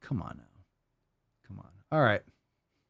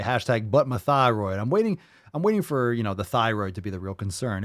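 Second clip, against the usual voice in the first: ordinary talk, nothing marked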